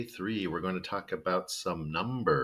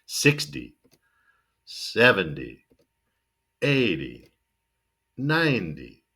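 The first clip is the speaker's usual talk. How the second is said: "Sixty, seventy, eighty, ninety" with weak "dee" endings; stress on first syllable; 3 dB louder, slower than usual. Shown as a demonstration. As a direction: hit the start of "Sixty" through "ninety", finish weak. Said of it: "Stress the first syllable and then finish kind of weak".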